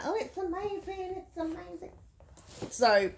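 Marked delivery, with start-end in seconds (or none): 0.0-1.9 high, soft mock gush; sending up booktubers who rave about the book. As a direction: parody the fans' breathless praise. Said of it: "They're like".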